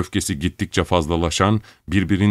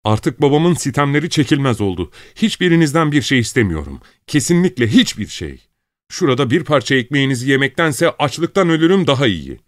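high, loud shrill yelling; shrill shout; 7 semitones higher, 3 dB louder than usual. Acted. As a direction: scream it at the top of lungs. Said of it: "tiz sesiyle avazı çıktığı kadar bağırarak".

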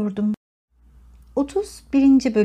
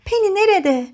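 raised, urgent question; alarmed; 7 semitones higher, 3 dB louder than usual. A narrator's reading. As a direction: call out anxiously for the child. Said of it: "telaş ifadesiyle". (telaşlı, tiz bir soru)

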